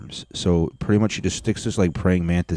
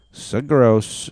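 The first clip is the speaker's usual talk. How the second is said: grossed out; 6 semitones higher, 3 dB louder than usual. Real, not acted. Reacting to worm video.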